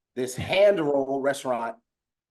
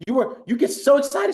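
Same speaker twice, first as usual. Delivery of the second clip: ordinary delivery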